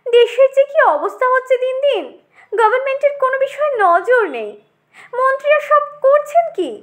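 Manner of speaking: high, fast, piping complaint; extremely thin, pointed voice. Acted. Tomato rails at government neglect, thin and piercing. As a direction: scold in a needle-thin high voice. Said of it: "অত্যন্ত সরু ছুচালো গলায়".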